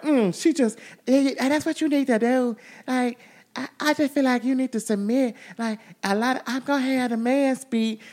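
high, soft mocking imitation of [name]. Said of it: "I'm listening to [name] like".

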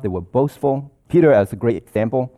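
normal talking voice

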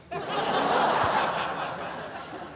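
airy, voiceless laugh at himself; breathy laugh. Real, not acted. Laughing at his own crash story, breathy laugh. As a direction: laugh under the breath at the memory.

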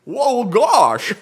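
high, loud goofy cartoon voice; cartoon dope exclaiming. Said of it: "you just sound like Goofy right there".

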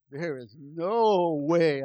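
drawn-out, very slow disbelief; slow stunned no way. Retold or imitated. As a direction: stretch it out like the price floored you. Stunned reaction to the price.

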